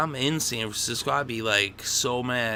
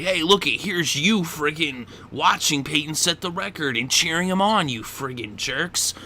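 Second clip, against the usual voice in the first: louder, sarcastic scolding of the team; coach's mock chewing-out; 6 semitones higher, 5 dB louder than usual. Harbaugh showing the players the film, loud mock chewing-out. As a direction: chew them out like a fed-up coach in film session.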